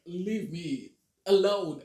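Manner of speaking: cold brush-off. Retold or imitated. Voicing a cold cast member.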